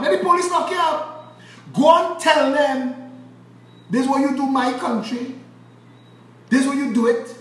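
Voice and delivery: high, loud urging, slowed on go and tell them; rally the elders like a street preacher; rousing call to action. Retold or imitated. Urging elders to storm parliament, high and loud.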